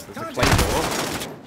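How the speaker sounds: slow, dragged-out mock repeat; mocking imitation. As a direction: parrot him like he's dim. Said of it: "God, Captain Obvious".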